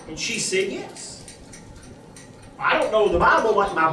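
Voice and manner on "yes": hushed yes. Retold or imitated. Wife's hushed answer.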